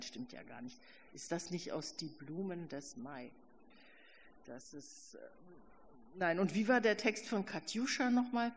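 ordinary delivery, nothing marked

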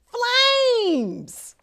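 high, thrilled cry of flames; excited shout. Acted. Hamster crying out his big idea, thrilled. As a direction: burst out with delight.

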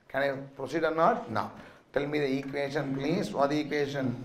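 normal talking voice

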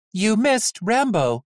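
high, slow robotic taunt; deadpan robot jab at the boy; robot nurse. Acted. Robot nurse mocking Vic for missing, slow and high.

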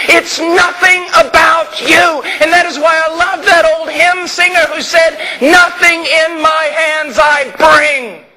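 shouted refrain, climbing; full-force preaching. Real, not acted. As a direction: drive home in Christ with mounting fervor. Building to a preaching climax.

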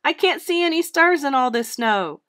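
high, loud flustered protest; blustering. A narrator's reading. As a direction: complain back at the bossy gadget. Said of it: "Santa blustered".

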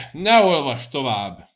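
loud, drawn-out angry interruption; sudden angry outburst. A narrator's reading. Cecei breaks in on the priest reading the letter, angry outburst. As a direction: snap out an irritated order. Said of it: "pattant fel Cecei".

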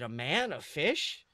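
ordinary delivery, nothing marked

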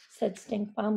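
ordinary delivery

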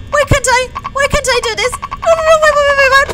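loud, frantic repeated protest; rising panic. Real, not acted. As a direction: blurt it as you lose control. Controls fail as danger comes.

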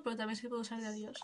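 ordinary delivery

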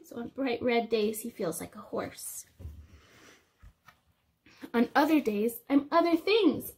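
lively storybook read-aloud; reading to children. Acted. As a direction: read it to the little ones. Reading picture book to class.

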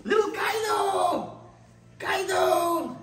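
high, slow loving call; squealy pet call. Real, not acted. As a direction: call the otter over sweetly. Calling the otter lovingly.

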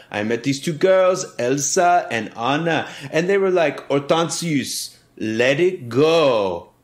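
put-on terrible French accent. Servant telling kids about meeting Elsa and Anna, in a hammy French accent. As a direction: spin a tall tale in bad French accent. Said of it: "it's a terrible French accent".

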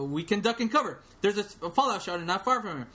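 Mansley scrambling to justify himself; nervous Mansley. Retold or imitated. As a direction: babble a quick panicked way out. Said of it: "read it in character of all the".

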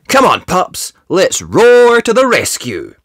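high, excited call to the team; rally the pups, soaring on "roar". Acted. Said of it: "he called".

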